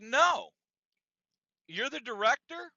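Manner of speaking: loud, high incredulous challenge; Bob peeved. Retold or imitated. Bob challenging the gym director, imitated peeved voice. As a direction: act out an irritated man challenging authority. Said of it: "He's angry. He's, he's a bit peeved".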